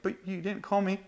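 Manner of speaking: high, mock-wounded child's complaint; butthurt granddaughter. Grandpa retelling his granddaughter's nickname complaint, amused mock hurt. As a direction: pitch it up like a miffed little girl. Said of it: "She was so butthurt".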